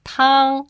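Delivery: high, even Mandarin "tang"; first tone. Shown as a demonstration. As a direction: sustain "tang" on one high note. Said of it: "The first tone, pronounced".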